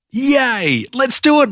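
high-pitched puppy excitement; excited bark. A narrator's reading. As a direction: cheer eagerly, pitch jumping right up. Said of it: "Maxie barked with excitement".

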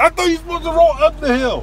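baffled, voice shooting up; incredulous. Real, not acted. Disbelief at car rolling back.

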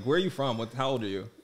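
usual speaking voice

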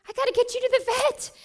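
the second time, pitch jumps up in panic. Retold or imitated. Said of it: "My first thought was".

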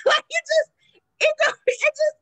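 high, loud laughing through the words. Real, not acted. Telling her friend about the surprise picture, laughing high. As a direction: crack up mid-sentence.

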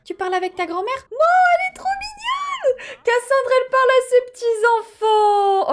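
very high, loud, drawn-out squeal of cuteness; squealing delight. Real, not acted. Player seeing grandma talk to grandkids, squealing. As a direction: shriek with joy at the sweetness, slowly.

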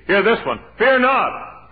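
high, loud preacher's call; proclaimed promise. Acted. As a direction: ring it out to the congregation.

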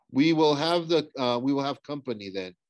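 usual speaking voice

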